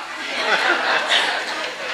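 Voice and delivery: a quiet laugh under the breath; soft breathy laugh. Real, not acted. Speaker laughing at the quote to the crowd, soft breathy laugh. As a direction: chuckle softly at the joke.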